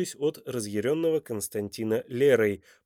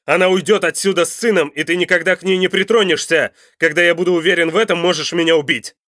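loud, fast, raised shouting; barked defiance; 6 semitones higher, 11 dB louder, faster than usual. A narrator's reading. Father demands her release, shouting fast and defiant. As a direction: shout terms down at him forcefully. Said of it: "рявкнул Константин".

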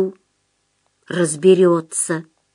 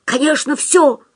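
firm assurance, pitched up; convinced; 7 semitones higher than usual. A narrator's reading. Остап reassures doubting Худяков, emphatically convinced. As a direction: assert it with higher, emphatic certainty. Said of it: "убеждённо сообщил Остап".

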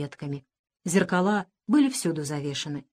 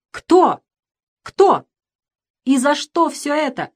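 loud, high-pitched demands; shouting; 7 semitones higher, 7 dB louder, slower than usual. A narrator's reading. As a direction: shout the questions in fury. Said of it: "кричал он".

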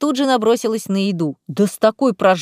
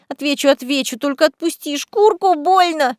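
high pained squeak, slowing down; 5 semitones higher than usual. A narrator's reading. Cat-girl squeaks at Lisa to let go, high squeal. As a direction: squeal it out, the skin hurts. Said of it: "пискнула Корнелия".

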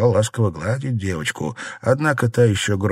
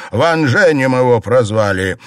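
loud, higher announcement; indignant cry; 4 semitones higher, 7 dB louder than usual. A narrator's reading. Husband reveals her brother's nickname, crying out. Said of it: "вскричал Цзялянь".

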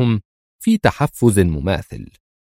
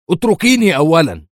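higher, louder yell to be let go; 8 semitones higher, 4 dB louder than usual. A narrator's reading. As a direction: shout to make her release him. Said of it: "صرخ عند هذه النقطة". (صرخة عالية ليتركوه)

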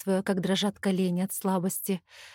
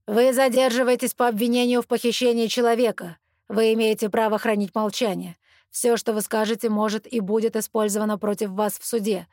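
loud formal police reading of rights; loud official announcement; 4 dB louder than usual. Acted. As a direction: recite the rights firmly and loudly. Officer reads arrest rights.

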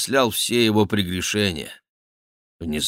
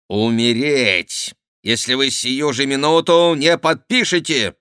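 commanding and harsh; 5 semitones higher, 5 dB louder than usual. A narrator's reading. The duchess ordering the king to sign or die, harsh and commanding. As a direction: lay down the ultimatum. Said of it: "повелительный и резкий голос герцогини".

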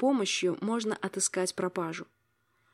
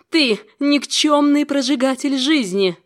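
loud, slow, furious scream; frantic scream; 5 semitones higher, 10 dB louder than usual. Acted. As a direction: scream it with all your rage. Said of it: "перешла на истошный крик". (истошный крик)

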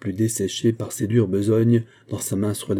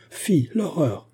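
horrified cry; 6 semitones higher, much slower than usual. A narrator's reading. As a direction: exclaim in genteel horror. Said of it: "cria madame de Boves". (petit cri horrifié, plus aigu)

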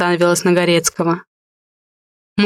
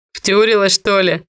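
ordinary delivery

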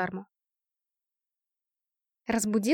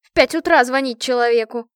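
loud outraged complaint; loud, indignant scolding; indignant shout; 8 dB louder than usual. A narrator's reading. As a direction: berate the early caller at volume. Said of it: "возмущённо крикнула она".

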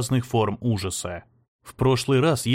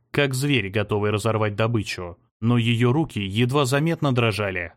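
ordinary delivery, nothing marked

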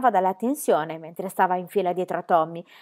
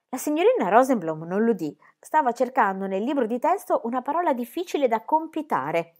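plain reading voice

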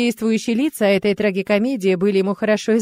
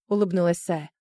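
usual speaking voice

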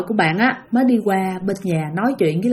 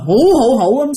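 mock ghost wail; 5 dB louder than usual. Imitating ghost crying.

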